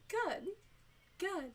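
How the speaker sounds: high-pitched little reply; pleased mock aside. Agent glad the caller can't hear, high mock-pleased reply.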